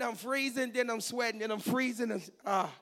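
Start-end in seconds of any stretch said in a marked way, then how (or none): none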